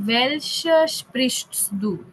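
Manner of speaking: "welche sprichst du?" drawn out very slowly; slow sample question. Shown as a demonstration. Teacher showing students an incomplete question, slowly. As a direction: lay the bare question out slowly. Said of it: "if I simply ask you".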